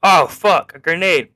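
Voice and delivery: high, loud acted exclamation; hammed-up jolt of surprise; mock alarm. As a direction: blurt it out like a startled bystander. Acts out bystanders seeing a grenade.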